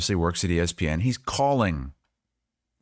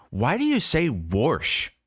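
higher, slow, swooping question; imitating puzzled niece; 6 semitones higher, slower than usual. Twelve-year-old niece calling out grandpa's pronunciation, mimicked in a higher, slow voice. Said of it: "And she said".

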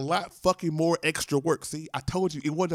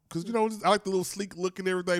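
ordinary talk, nothing marked

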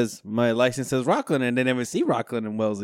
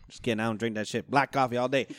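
ordinary talk, nothing marked

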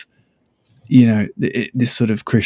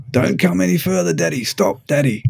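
imitating his daughter, higher, bossy; mock little daughter; 7 semitones higher than usual. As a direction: play the small girl ordering daddy back. Daughter voiced stopping dad.

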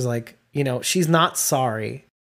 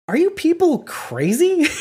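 high, slow disbelieving question; mock incredulity at the complainers; incredulous; 12 semitones higher, slower than usual. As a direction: scoff at the mourning fans. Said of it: "But Becky says".

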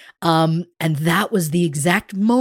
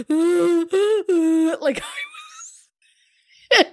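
laughing; 12 semitones higher, much slower than usual. Real, not acted. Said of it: "I was just like".

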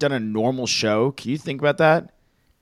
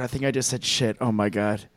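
ordinary talk, nothing marked

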